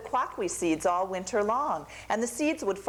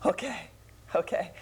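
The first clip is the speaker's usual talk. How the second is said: gasping, then a high crying wail; frightened gasp. Acted. Little Dove scared by Coyote's threat, gasping then crying. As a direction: gasp in fear and break into a wail.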